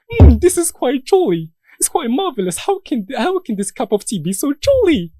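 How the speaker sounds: high, loud hammed-up posh British gent; mock posh British accent. Debater mocks a British tea lover in a posh British accent. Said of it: "imagine a British guy coming to you and telling you".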